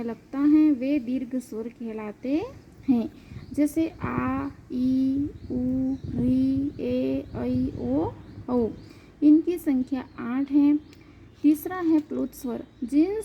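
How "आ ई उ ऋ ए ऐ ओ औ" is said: आ through औ, each vowel held long and level. Shown as a demonstration. Teacher showing students the two-beat vowels, drawn out long. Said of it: "दुगुना समय अर्थात दो मात्राओं का समय".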